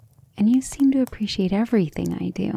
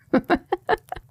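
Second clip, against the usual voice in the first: high playful giggle; 10 semitones higher than usual. Acted. Teasing lover about liking capable women.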